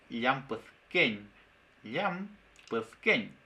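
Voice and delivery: "llampuezquén" slowly, opening on a palatal L; Mapudungun LL. Shown as a demonstration. Teacher giving the butterfly example, Mapudungun LL. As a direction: sound out "llampuezquén" in slow, separated syllables. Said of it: "emite un sonido muy particular".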